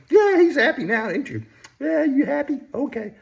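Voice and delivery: high cooing voice to a pet; baby talk. Pretend. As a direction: coo sweetly at the bunny. Baby talk to toy bunny.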